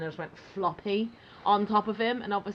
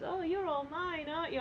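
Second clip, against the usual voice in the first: mock tender; 8 semitones higher, 7 dB quieter than usual. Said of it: "and he says".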